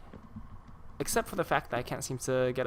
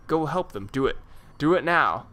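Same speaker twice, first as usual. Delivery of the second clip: louder, pressing order; urgent command; 3 semitones higher, 7 dB louder than usual. Acted. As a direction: push them to go now. NPC urging the hero.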